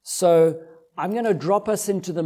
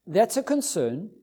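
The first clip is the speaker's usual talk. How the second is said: ordinary delivery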